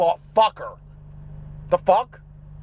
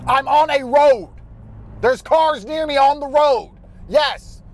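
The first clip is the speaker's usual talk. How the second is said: exasperated shout; 5 semitones higher, 4 dB louder, slower than usual. Real, not acted. Ranting about parking sensors.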